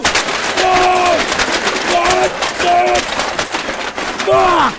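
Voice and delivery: loud, high, real yells of rage; furious outburst. Real, not acted. Host exploding at a sabotaged call, furious yelling. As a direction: explode at the ruined call, no holding back.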